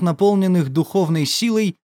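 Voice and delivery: ordinary delivery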